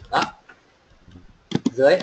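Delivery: ordinary delivery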